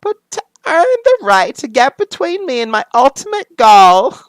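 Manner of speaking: very high, loud cartoon squeal; hammed-up Harley Quinn. Harley challenging her guest of honor, hammed-up squeaky voice. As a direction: push it up into a silly squeaky villainess. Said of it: "I'm not gonna do that anymore".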